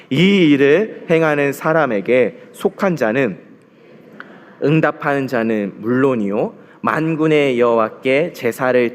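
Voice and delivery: slow, measured reading of the verse; solemn scripture recitation. Acted. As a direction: read the verse together, deliberately. Congregation reads scripture aloud.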